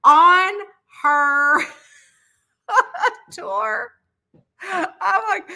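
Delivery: high, loud words through laughter; high laughing while telling the story. Real, not acted. Laughing retelling mic grab.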